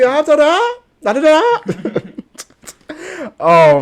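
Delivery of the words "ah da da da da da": high, loud da-da-da cutting off a player; mock whistle blowing. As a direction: imitate a ref silencing cussing. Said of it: "I'd be".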